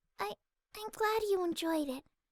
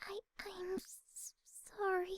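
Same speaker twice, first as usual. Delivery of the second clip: barely voiced, halting apology; timid whisper; 9 dB quieter than usual. Fluttershy whispering her apology to Rainbow. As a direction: whisper it, too shy to speak.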